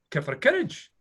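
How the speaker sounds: put-on "Get for a carriage" in a mild Scottish accent, with the vowels run together; retelling a Scottish driver's offer in his own accent; imitating a Scottish accent. Mock Scottish man offering a passing walker a carriage. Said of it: "he was speaking in Scottish English".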